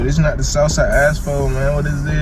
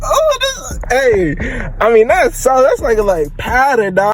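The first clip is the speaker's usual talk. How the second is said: much higher, squealing disbelief; shocked; 11 semitones higher than usual. Real, not acted. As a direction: yelp it out at the sight. Shocked by amount of sauce.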